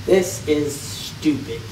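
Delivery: very low and very slow; slowed deep voice. Acted. Kid mocking his own video in a slowed deep voice. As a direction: drag it out like a slowed tape.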